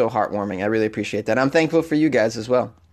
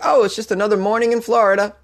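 unbothered shrug; 7 semitones higher than usual. Pretend. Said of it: "I'd be like".

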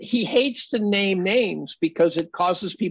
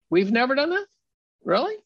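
sarcastic retort; 6 semitones higher than usual. Retold or imitated.

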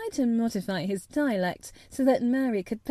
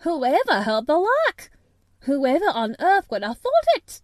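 higher, louder amazed cry; astonished; 5 semitones higher, 6 dB louder than usual. A narrator's reading. Loud, high astonished Yorkshire. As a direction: housekeeper amazed at the laughter behind the door. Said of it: "she was so astonished".

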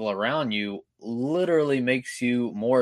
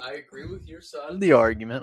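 quick, high mocking imitation; mimicking her friend; 5 semitones higher, faster than usual. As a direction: parrot her words back, teasing. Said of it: "You just walked in like".

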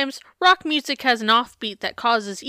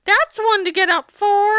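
loud, high, drawn-out fake laugh; hammed-up imitation of an oblivious woman; mock laughing mother; 10 semitones higher, 7 dB louder, slower than usual. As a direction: exaggerate her breezy unconcern, high and loud. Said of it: "this woman's just like".

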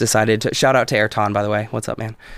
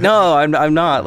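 emphatic denial; 6 semitones higher, 6 dB louder than usual. Retold or imitated. Insisting he is not Balkan.

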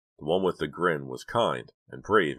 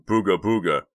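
mock scream; 3 semitones higher, 7 dB louder than usual. A narrator's reading. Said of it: "sat up screaming".

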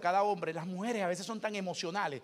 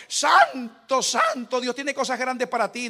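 mock prophetic voice; 4 semitones higher, 9 dB louder, much slower than usual. Mock prophetic brother quoted.

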